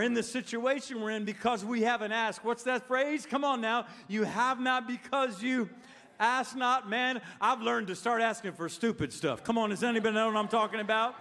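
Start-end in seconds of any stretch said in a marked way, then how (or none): none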